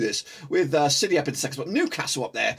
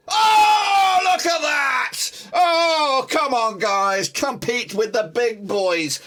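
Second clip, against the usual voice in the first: high, loud cry of triumph; ecstatic celebration; 9 semitones higher, 5 dB louder, much slower than usual. Real, not acted. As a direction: shout it out, stretching the words in delight. Sees his big jump, celebrates.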